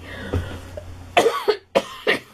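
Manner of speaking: breathy dry cough fit; coughing from inhaled dust. Real, not acted. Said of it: "I inhaled some dust".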